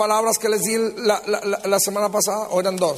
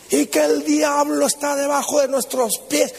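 higher-pitched, mock fire-and-brimstone preaching; fiery preacher; 4 semitones higher than usual. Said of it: "predicando así con poder y fuego".

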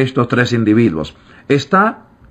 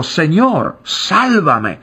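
higher, crying out for rescue; desperate cry; 5 semitones higher, much slower than usual. Acted. Pedro sinking and crying to the Lord, desperate higher cry. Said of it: "un grito".

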